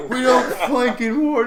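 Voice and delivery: high, stern scolding voice; mock Russian soldier. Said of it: "And he's like".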